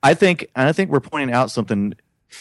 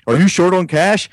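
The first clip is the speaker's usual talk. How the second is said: lightly imitated ad pitch, higher and louder; commercial pitch; 6 semitones higher, 5 dB louder than usual. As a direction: mimic a loud TV cash pitch. Quoting a cash-ad pitch.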